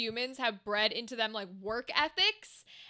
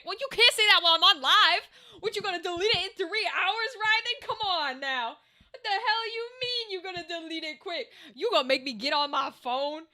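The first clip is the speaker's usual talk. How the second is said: higher, louder, playfully indignant; mock outrage; 5 semitones higher, 4 dB louder than usual. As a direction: scold them in playful disbelief.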